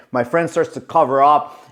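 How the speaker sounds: ordinary talk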